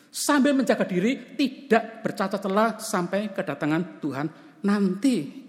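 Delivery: high, loud preaching, slowing at the end; fervent preaching. Acted. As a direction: drive the point home from the pulpit. Preacher stresses the true hope. (khotbah berapi-api, tinggi dan keras)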